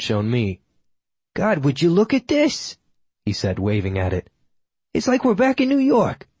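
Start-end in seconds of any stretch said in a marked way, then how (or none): 1.3-2.8 high, drawn-out exclamation; indignant old regular. A narrator's reading. Senior regular waving the newspaper, in indignant high voice. 4.9-6.3 very high put-on character voice; indignant old regular. A narrator's reading. Senior regular griping about the article, in indignant high voice.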